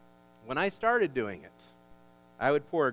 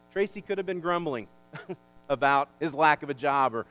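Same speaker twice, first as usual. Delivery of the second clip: rising, louder preacher build; preaching at full force; 4 semitones higher, 8 dB louder than usual. Real, not acted. Pastor to congregation, preaching at full force. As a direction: drive the point home to the congregation.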